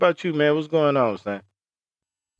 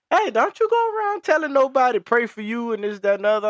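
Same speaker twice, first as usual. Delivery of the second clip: put-on high scolding old lady, pitched way up; 13 semitones higher than usual. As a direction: imitate the lady warning him off. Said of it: "she was like".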